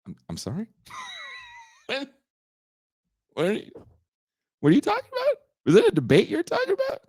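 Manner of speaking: high, squeaky incredulous questioning; baffled disbelief. Acted. Replying to a confused liberal friend, acted high-pitched bafflement.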